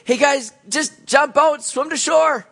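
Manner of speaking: high, loud and slow, calling across the water; mock call out. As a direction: shout it out to men in a boat, drawn out. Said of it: "call out to them and say".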